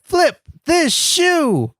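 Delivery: high, slow hype cry without real volume; mock yell. Shown as a demonstration. Suggesting a family friendly catchphrase, high mock yell. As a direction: stretch it out like a catchphrase. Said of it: "yell something more family friendly".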